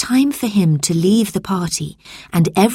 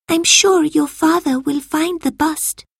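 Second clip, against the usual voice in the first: put-on reassuring girl voice; 11 semitones higher than usual. A narrator's reading. Camilla comforting.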